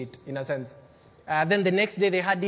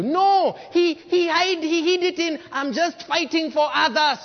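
high, loud preaching outburst; preacher exposing envy posing as fighting for others; emphatic no; 9 semitones higher, 6 dB louder, slower than usual. Real, not acted. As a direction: hammer home the hidden envy.

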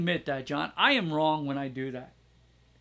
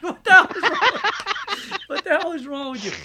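laughing self-scolding; 11 semitones higher, 10 dB louder than usual. Retold or imitated.

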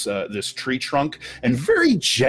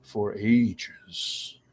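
tree guardian voice; much slower than usual. Acted.

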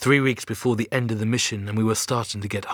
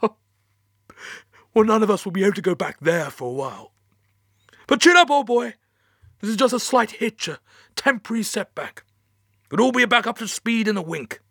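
high, loud, hearty stiff upper lip; forced cheer; 11 semitones higher, 5 dB louder than usual. Acted. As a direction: brave face after bad news. Boss forces cheer after bad news.

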